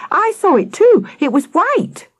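high, excited insistence; calling after someone. A narrator's reading. Sam insisting to Akela, calling out high. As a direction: pitch it high and call out. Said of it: "Sam called after Akela".

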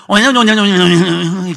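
loud, slow droning babble; imitated tongues. Boy alone on the windowsill, loud imitated speaking in tongues. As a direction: run nonsense syllables into one long loud stream. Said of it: "계속 방언을 하고".

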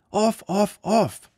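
put-on high, loud child's cry; a small boy yelling in a panic; boy shouting. A narrator's reading. The boy shouting at Jurin to get off.

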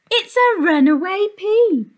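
high, loud "a runaway pea!" exclamation; surprised. A narrator's reading. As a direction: gasp it out with delight.

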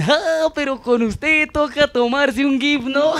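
high, slow, mocking fan voice; mocking fan joke. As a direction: drag out the fan's joke slowly. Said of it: "a mí me dicen".

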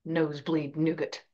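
under his breath. A narrator's reading. Professor adding an aside under his breath, muttered low. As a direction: mutter it quietly to yourself. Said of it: "Under his breath, he added".

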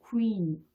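"queen" slowly linked, ku into ee; "queen" with rounded w and long ee; English queen. Shown as a demonstration. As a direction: stretch the glide in queen. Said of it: "연결해서 발음을 한다라고".

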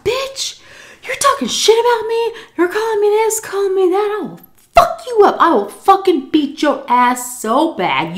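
high-pitched trash talk; re-enacted high, ranting threat; drunken fighting threat. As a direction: go off on them, voice way up high. Acted drunken threat before a fight.

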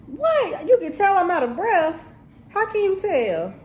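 high-pitched, incredulous protest; indignant surprise. Real, not acted. Cook questions someone off mic who noticed their breathlessness, indignant surprise. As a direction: shoot back in high-pitched disbelief. Challenged about being out of breath.

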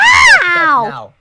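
loud slow shout. Acted. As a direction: yell it, stretched and stern. Yelling at game character.

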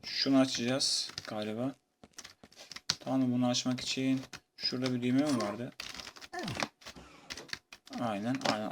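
normal talking voice

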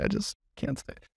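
put-on breathless whisper of a choked-up kid; hushed, tearful whisper. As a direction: barely get the words out, voice gone. Boy's tearful prayer retold.

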